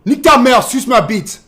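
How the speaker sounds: loud, high French cursing; shouted insult demo. Shown as a demonstration. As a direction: belt out French insults, starting hardest. Demonstrating French insults loudly.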